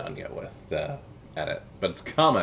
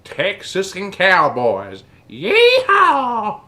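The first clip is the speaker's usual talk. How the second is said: high, loud, drawn-out cowboy yell; hammed-up Texan cowboy, shouted; cowboy holler; 12 semitones higher, 14 dB louder, much slower than usual. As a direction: build to a whooping rodeo cry. Voicing a cowboy cheer.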